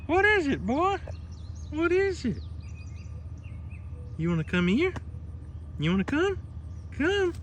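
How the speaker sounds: high coaxing puppy voice; talking to a dog. Real, not acted. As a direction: call the dog over sweetly.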